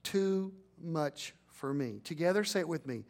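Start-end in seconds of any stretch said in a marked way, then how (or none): 0.0-2.0 slow, each word spaced out; slow call to repeat. Shown as a demonstration. Pastor leading congregation to repeat, slowly. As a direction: lead them through it slowly. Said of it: "I want you to say it this way".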